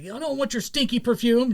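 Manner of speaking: high-pitched mock cartoon boy; Ash Ketchum. Fan retells Ash at the perfume shop, high imitated boy voice. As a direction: imitate a kid's snub, pitched well up. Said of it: "He's just like".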